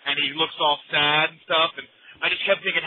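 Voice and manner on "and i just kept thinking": usual speaking voice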